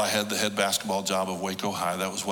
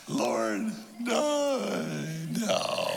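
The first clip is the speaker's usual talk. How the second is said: laughing refusal; 6 semitones higher, much slower than usual. Retold or imitated. Laughing off God's question.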